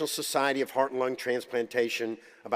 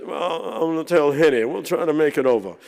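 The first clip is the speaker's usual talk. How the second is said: higher and louder, in an old surgeon's put-on voice; quoting the great surgeon in a louder, higher voice; imitating DeBakey; 4 semitones higher, 8 dB louder than usual. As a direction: slip back into the old master's voice. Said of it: "I learned to imitate all these guys".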